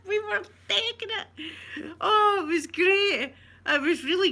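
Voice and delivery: high, laughing delivery; talking through laughter. Real, not acted. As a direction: still cracking up as you speak.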